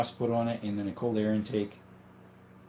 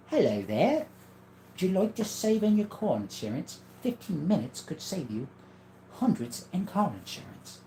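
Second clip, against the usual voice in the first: a light, higher-pitched take on the Gecko ad voice; Geico Gecko impression; 8 semitones higher than usual. Reading a chat line as the Geico Gecko, light impression. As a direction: pitch the insurance pitch up like the ad lizard. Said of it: "Nice Geico impression".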